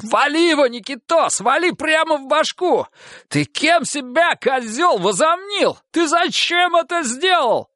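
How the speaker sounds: high frantic yelling; enraged shouting. Acted. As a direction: scream at him in rage.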